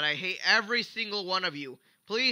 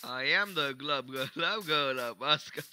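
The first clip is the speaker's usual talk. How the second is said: slow, low gurgling fish croak; gurgling fish man croak; 3 semitones lower, slower than usual. Pretend. Repeats fish voice bit.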